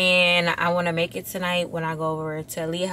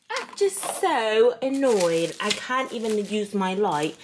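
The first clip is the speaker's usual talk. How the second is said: put-on British accent, lightly done; playing a posh Londoner in a British accent. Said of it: "I like me better with the British accent".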